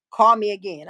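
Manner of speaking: usual speaking voice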